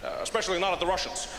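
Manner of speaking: halting, careful presidential impression; Barack Obama. President backing away from blaming Russians, hesitant measured impression. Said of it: "Obama was like".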